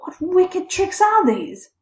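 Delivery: high, slow, indignant scolding. A narrator's reading. Terrified father to his boy, scolding. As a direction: draw out the shocked reproach. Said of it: "scolded the boy".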